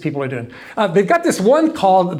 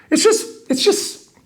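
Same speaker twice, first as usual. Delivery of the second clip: exasperated; slower than usual. Real, not acted. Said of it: "speaker lost for words".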